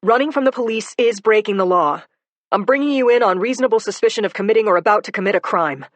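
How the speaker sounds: loud, fast official recital; firm loud warning. Acted. Cop telling the suspect why he's being brought in, loud and fast. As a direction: rattle off the legal grounds firmly.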